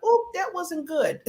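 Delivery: high, slow mock dismay at her own email. Rereading her own sharp email, a high, slow sheepish wince. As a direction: draw it out high, sheepishly caught out.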